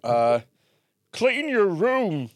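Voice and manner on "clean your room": Jordan Peterson impression. Friend asks him to do Jordan Peterson, lecturing impression. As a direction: preach like a stern self-help lecturer. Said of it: "try and do Jordan Peterson".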